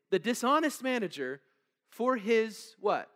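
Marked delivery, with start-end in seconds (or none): 1.9-3.1 slow prompting question. Real, not acted. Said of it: "Read that word".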